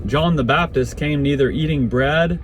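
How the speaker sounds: plain reading voice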